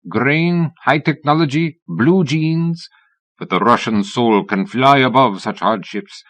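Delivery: playful put-on Russian accent. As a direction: lightly ham up a Russian accent to tease her. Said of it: "adopting a Russian accent".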